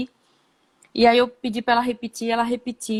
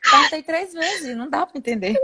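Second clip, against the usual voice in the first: laughing; 6 semitones higher than usual. Real, not acted. Laughing retelling.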